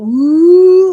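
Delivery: loud, high teasing ooh; a singsong schoolyard ooh at someone in trouble; exaggerated taunting ooh; mocking ooh. Group mocking a sent-away kid.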